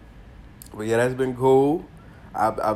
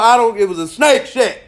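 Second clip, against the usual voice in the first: emphatic; 11 semitones higher, 8 dB louder than usual. Real, not acted. Insisting it was a snake.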